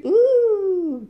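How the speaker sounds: high, loud, long "ouh"; swooping high ooh of exaggerated wonder; playful "ooh". Shown as a demonstration. Teacher puzzling over the next punctuation mark, playful ooh.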